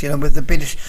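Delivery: usual speaking voice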